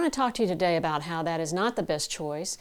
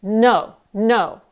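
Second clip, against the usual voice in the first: strong, much louder no sent far; projected no across the room; 7 dB louder than usual. Shown as a demonstration. Coach projects across a noisy room, much louder. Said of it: "project it across the room".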